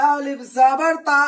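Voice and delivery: teacher's careful letter-by-letter reading; slow spelling-out. Shown as a demonstration. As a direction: spell अलिफ जबर ता slowly with a long ता. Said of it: "इसी को कहते हैं अलिफ मद्दा".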